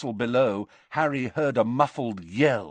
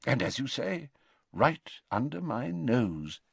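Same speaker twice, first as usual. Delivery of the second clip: gently wry wise wizard; calm old headmaster; 6 semitones higher than usual. A narrator's reading. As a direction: headmaster praising the plan under his nose. Dumbledore praising.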